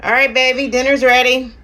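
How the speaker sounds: calling out to the next room; yelling across the room. Real, not acted. Calling husband to dinner.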